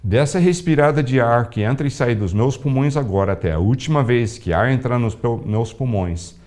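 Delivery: hushed surrender to the Lord; prayer of surrender. Retold or imitated.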